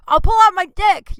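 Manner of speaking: high, loud mock shout of a desperate comic; mock crude yell. Comic imitates a desperate performer, mock yell. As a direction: blurt it like a bar comic losing the room.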